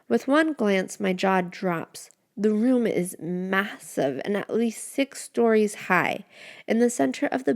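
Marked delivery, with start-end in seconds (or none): none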